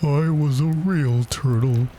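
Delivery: high, slow, mournful lament; wistful turtle sighing over the past; sighing sadness. A narrator's reading. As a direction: draw it out dolefully. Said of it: "with a deep sigh".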